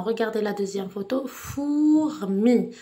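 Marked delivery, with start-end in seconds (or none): 1.3-2.7 "Fourmi" with a long drawn-out Four, then mi; split into syllables. Shown as a demonstration. Teacher divides the second photo's word, "Fourmi" syllable by syllable. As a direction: stretch "Fourmi" slowly over two separate beats. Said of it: "Vous allez couper le mot en syllabes".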